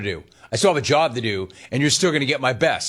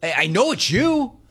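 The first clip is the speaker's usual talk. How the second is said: high, pleading and incredulous, building fast at the end; pleading disbelief; 8 semitones higher than usual. Retold or imitated. Host to Gino, pleading in disbelief, voice climbing high. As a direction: beg him to admit it's really him, voice climbing. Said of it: "I'm like".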